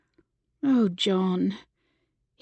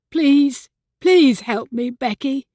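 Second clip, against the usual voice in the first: pleading, pushed up and louder; imploring; 4 semitones higher, 5 dB louder, slower than usual. A narrator's reading. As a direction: beg her outright.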